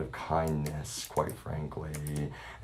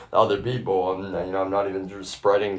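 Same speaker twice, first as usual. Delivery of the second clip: usual speaking voice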